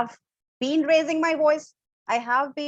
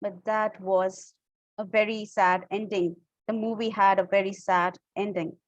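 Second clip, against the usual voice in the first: sad, low pitch; 4 semitones lower than usual. Shown as a demonstration. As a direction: lower the pitch to show sadness. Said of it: "if you are very sad and you are talking like".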